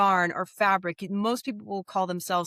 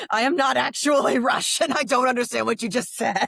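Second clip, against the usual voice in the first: put-on higher, laughing Russian accent; 5 semitones higher, 5 dB louder than usual. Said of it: "my accent was so convincing".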